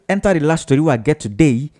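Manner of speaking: usual speaking voice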